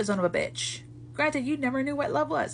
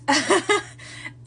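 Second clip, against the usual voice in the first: short, high laugh; brief self-amused laugh; 6 semitones higher than usual. Real, not acted. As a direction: laugh at your own outburst. Laughs at getting heated.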